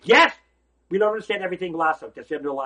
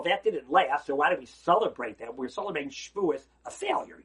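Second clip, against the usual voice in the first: ordinary delivery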